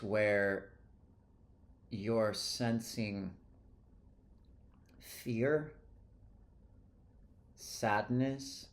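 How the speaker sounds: unhurried, easing them into the body; guided meditation. Real, not acted. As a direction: lead a calm energy clearing, leaving space after each phrase. Leads an energy clearing meditation.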